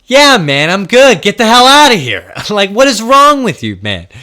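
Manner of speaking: high, loud mock yell to get out; hammed-up shout, half laughing; mock yell at roommate. Telling the half-dressed roommate to get out, mock shout. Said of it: "I was like".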